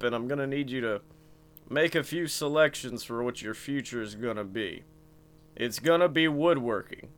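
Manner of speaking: deadpan it low and unimpressed like Ron Swanson; Ron Swanson impression. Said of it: "Ron Swanson".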